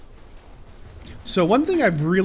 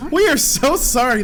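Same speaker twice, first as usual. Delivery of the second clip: mock apology; 9 semitones higher, 4 dB louder than usual. Real, not acted. Apologising to Poop King.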